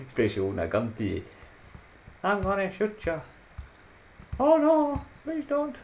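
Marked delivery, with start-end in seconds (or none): 2.2-3.2 high put-on threat; gunwoman voice. Voicing the gun-holding woman in a gif, high mock threat. 4.4-5.7 very high, pleading squeak; mock victim. Playing the victim of the gif gunwoman, squeaky plea.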